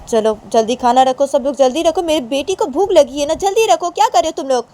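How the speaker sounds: loud, high, impatient commands; urgent high-pitched shouting; mother ordering servants. Acted.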